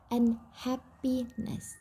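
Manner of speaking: unhappiness said slowly, un, happy, ness; slow, separated un-happi-ness for a class; word broken into parts. Shown as a demonstration. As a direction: split unhappiness into three drawn-out parts. Teacher breaks word into morphemes.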